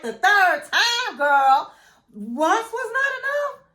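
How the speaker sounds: high, slow disbelieving jab with a laugh; incredulous sass. Retold or imitated. Host reacting to Denise's story, high, slow and laughing in disbelief. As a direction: drag out the third time, girl in mock shock. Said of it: "I'm looking at her like".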